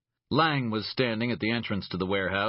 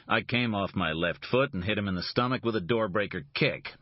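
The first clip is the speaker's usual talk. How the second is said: ordinary delivery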